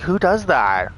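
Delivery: high, loud incredulous retort; shocked disbelief. Retold or imitated. Doctor scoffing at the patient's test. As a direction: toss the question back, stunned.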